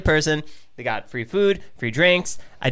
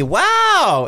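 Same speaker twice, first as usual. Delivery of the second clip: high, drawn-out sarcastic wow; mock amazement; 12 semitones higher, 4 dB louder than usual. Friend reading out the fact he doubted, mock-amazed. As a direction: feign astonishment, pitch soaring.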